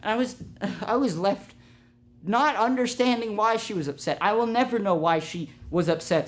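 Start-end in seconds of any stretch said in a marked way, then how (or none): none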